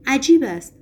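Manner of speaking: narrated exclamation, pitch jumping up; exclaiming. A narrator's reading. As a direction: cry it out in character, short of shouting. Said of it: "ریمیسکی فریاد زد".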